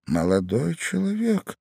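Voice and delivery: usual speaking voice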